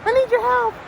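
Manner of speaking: teacher imitating the lunch lady calling for help, pitched high.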